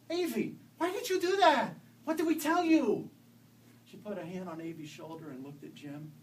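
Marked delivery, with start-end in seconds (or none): 0.1-3.1 high, loud scolding mother. A narrator's reading. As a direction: scold her, voice rising. Mother scolds child loudly.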